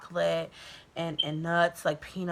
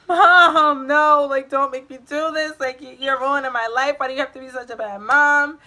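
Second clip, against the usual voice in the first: put-on pleading teen; 6 semitones higher, 7 dB louder than usual.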